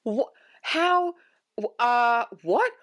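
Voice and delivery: high, breathless stammer, half whispered; stammering confusion. Retold or imitated. Stunned viewer sputtering at guns pointed at her, high and stammering. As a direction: sputter like words fail you. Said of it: "And I'm like".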